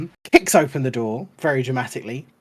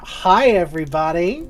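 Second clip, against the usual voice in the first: bigger, louder hi everybody; louder second greeting; 5 semitones higher, 7 dB louder than usual. Real, not acted. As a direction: call it out again with more energy. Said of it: "Come on now".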